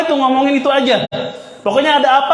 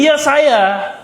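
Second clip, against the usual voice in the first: usual speaking voice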